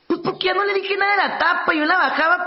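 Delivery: a wife telling the counselor how things got better, whiny. Retold or imitated. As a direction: explain it like a wife telling her story. Counselor voices wife explaining.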